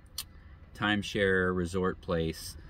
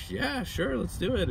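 eager yes; 12 semitones higher than usual. Retold or imitated. Stranger eagerly agrees.

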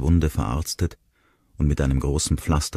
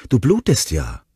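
high, alarmed cry; startled panic at seeing blood; panicky cry; 8 semitones higher, 4 dB louder than usual. Acted. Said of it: "rief sie voller Panik". (voller Panik, hoch gerufen)

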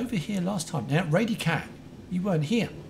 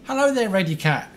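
raised, excitable hello; loud greeting; 5 semitones higher, 6 dB louder than usual. Real, not acted. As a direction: call out a loud, high greeting. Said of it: "I was a bit loud then".

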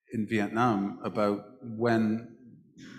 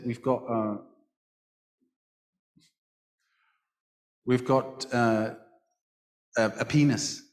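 deadpan. Retold or imitated. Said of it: "this was deadpan".

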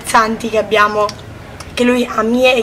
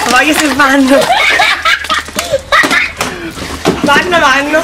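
laughing through the words; 3 semitones higher than usual. Real, not acted. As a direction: giggle between the words. Laughing while answering.

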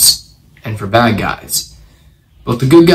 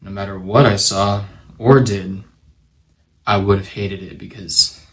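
subdued confession; 3 semitones lower, 6 dB quieter than usual. A narrator's reading. Widowed ex-marshal confesses his guilt over his wife's murder, subdued. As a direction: let the guilt sink in, softly and haltingly.

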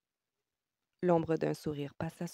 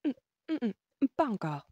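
high, preoccupied refusal; talking to herself; 8 semitones higher, 4 dB louder than usual. A narrator's reading. Woman answering more to herself than him, distracted and high. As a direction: hum it away as if thinking aloud. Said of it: "qu'elle se parlait à elle-même plutôt qu'à lui".

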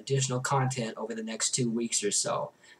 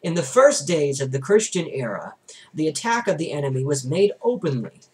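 steady, earnest reading of a religious text; book reading; 4 semitones higher, 6 dB louder than usual. A narrator's reading. Reader narrating a theology book to listeners, in an earnest reading voice. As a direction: read it aloud like a sermon from the page.

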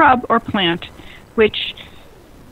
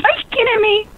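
much higher squeal of mock disbelief; 12 semitones higher than usual. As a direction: squeal it like you can't believe the deal. Repeats hype line, far higher.

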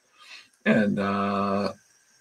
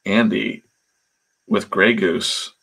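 usual speaking voice

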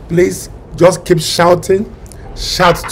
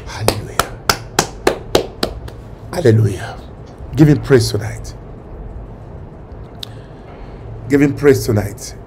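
much lower, softer worship murmur; hushed praise; 7 semitones lower, 9 dB quieter than usual. Acted. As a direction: hush into quiet worship. Quiet after climax.

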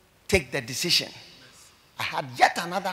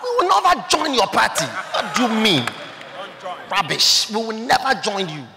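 loud, raised-pitch defiant retort; 5 semitones higher, 5 dB louder than usual. Real, not acted. Preacher answering those who demand he join their party, loudly defiant. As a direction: throw the demand back forcefully.

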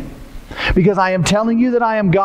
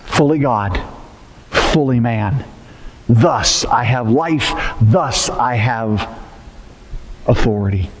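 voice of Jesus; 7 semitones lower than usual. Acted. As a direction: speak as Jesus, deep and weighty, low and unhurried.

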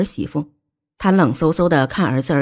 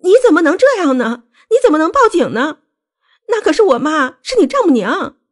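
very high, piercing protest; shrill, worked-up wife; sharp, agitated; 10 semitones higher, 4 dB louder than usual. A narrator's reading. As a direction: shoot the voice up in outraged reproach. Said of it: "声音也异常的尖锐".

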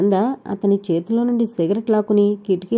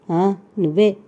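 ordinary delivery

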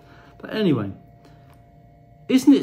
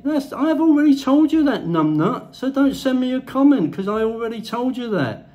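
much higher and louder, fed-up scolding; irritated retort; 8 semitones higher, 3 dB louder than usual. Real, not acted. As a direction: tell them off, genuinely irritated. Fed-up reply to imagined critics.

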